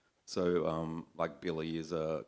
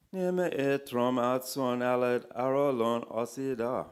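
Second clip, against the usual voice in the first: higher Italian-style incantation; backwards Italian; 6 semitones higher, 4 dB louder than usual. A narrator's reading. Devil replies to a prayer in backwards Italian, intoned. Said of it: "in backwards Italian".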